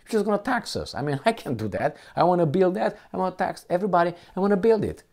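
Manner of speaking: high mocking voice, parroting his plans; high, sarcastic mimicry of a politician. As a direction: sneer at his tax-and-build promises.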